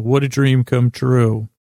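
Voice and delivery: ordinary talk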